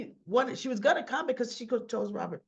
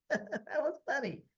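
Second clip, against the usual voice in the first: real laugh, higher, into the words; 6 semitones higher than usual. Real, not acted. Vlogger laughing at Robert's line, real laugh. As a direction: laugh it off at the scene.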